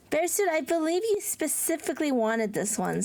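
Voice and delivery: ordinary talk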